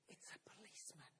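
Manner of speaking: voiceless hushed answer; whispered. Retold or imitated. Child whispering to the worried boss on the phone. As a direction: whisper it like a secret. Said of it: "the whispered response".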